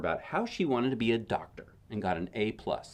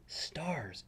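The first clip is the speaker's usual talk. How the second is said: whisper; 6 semitones higher, 9 dB quieter than usual. Real, not acted. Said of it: "reader whispers while browsing pictures".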